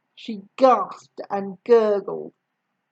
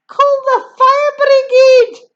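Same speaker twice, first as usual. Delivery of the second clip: high, loud panicked shout; 13 semitones higher, 10 dB louder than usual. A narrator's reading. Grandma burning inside, high loud shout. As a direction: yell for help in a panic. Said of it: "she shouted suddenly".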